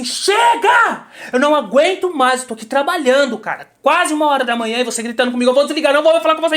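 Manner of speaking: fed-up outburst at the director, voice shooting up; retold furious shout. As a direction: yell it high and loud, rushing toward the end. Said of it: "E aí eu gritei, falei assim". (grito de quem não aguenta mais)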